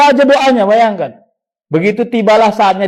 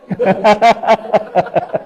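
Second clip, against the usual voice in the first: laugh; 3 dB quieter than usual. Real, not acted. Laughing at own joke.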